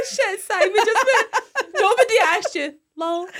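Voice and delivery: high and loud through laughter; giggly, high-pitched quoting; laughing retelling. Real, not acted. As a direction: retell it while cracking up. Retelling the comeback, cracking up.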